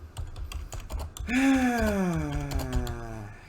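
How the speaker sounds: long, voiced sigh sliding down in pitch. Real, not acted. Student coder pausing mid-tutorial, long voiced sigh. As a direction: let out a drawn-out, falling sigh.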